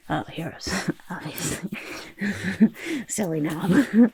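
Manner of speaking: words broken up by small chuckles; sheepish, self-mocking laugh; self-amused chuckling. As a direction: giggle at your own silly question. Laughing at own silly question.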